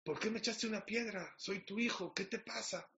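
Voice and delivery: high-pitched mock angry protest; acted indignation. Son to father who threw a stone at him, acted indignation. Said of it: "Muy enojado".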